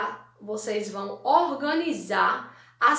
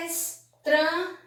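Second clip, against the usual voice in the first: ESTRANHO split as es, tra, nho; syllable by syllable; 5 semitones higher, slower than usual. Shown as a demonstration. Teacher showing how estranho was separated, syllable by syllable. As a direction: say estranho slowly, pausing between syllables. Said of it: "Veja a palavra estranho como foi separada".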